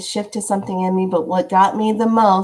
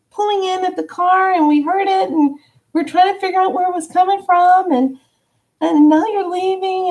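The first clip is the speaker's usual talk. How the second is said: excited lady; 12 semitones higher than usual. Retold or imitated. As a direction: gush it up high like an eager stranger. Retelling excited lady's words.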